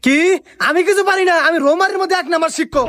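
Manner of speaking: high-pitched wounded pride; indignant boasting. Acted. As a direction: protest hotly that you are the best. Teacher rebuts accusation of incompetence.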